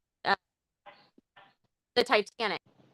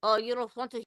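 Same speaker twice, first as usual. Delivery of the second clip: ordinary talk, nothing marked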